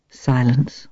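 usual speaking voice

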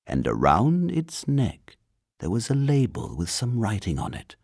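usual speaking voice

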